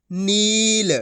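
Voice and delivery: नील with a long, sustained ee; drawn-out long ee. Shown as a demonstration. Teacher joins the letters for learners, long ee drawn out. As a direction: stretch the middle vowel of नील. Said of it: "इसी तरीके से पढ़ना आपको".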